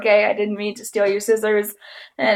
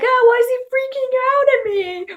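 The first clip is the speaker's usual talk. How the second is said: put-on high, bewildered young voice; confused kid; 13 semitones higher than usual. Young girl puzzled by the boy's outburst, high confused voice. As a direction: play the clueless kid, puzzled. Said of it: "so I was like".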